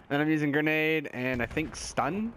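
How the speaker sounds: normal talking voice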